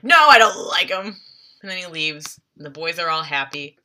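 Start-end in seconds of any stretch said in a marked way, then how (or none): 0.0-1.2 gruff denial. Retold or imitated. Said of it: "he's like".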